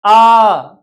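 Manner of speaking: आ held long and loud; long vowel aa. Shown as a demonstration. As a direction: stretch आ to twice the length. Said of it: "लेकिन यहाँ पे". (लंबा आ)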